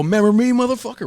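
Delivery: high-pitched taunting confrontation; spring it on them in a high, heated voice; re-enacted angry gotcha; acted menacing challenge. Acted confrontation after finding them.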